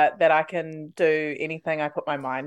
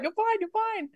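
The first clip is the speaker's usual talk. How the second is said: coach reassuring; 5 dB quieter than usual. Retold or imitated. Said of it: "And he's like".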